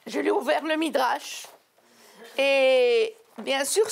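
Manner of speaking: normal talking voice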